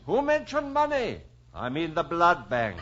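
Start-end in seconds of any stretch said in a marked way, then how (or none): 0.0-1.2 high incredulous question; mock surprise. Dad setting up the blood bank joke, surprised.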